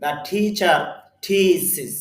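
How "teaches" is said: thesis as "tee-sis", syllables held apart; careful thesis, long "tee" then "sis"; correct pronunciation. Shown as a demonstration. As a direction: model thesis with an i in the last syllable. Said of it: "তাহলে উচ্চারণটা হবে".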